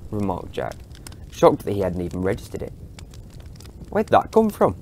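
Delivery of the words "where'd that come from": amazed. Acted. Jack amazed at the police box.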